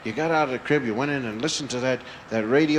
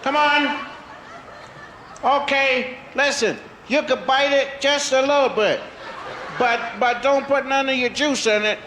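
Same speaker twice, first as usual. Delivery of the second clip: calling from far away; 12 semitones higher than usual. Acted. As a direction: call out to the snakes, pleading. Child pleads with snakes.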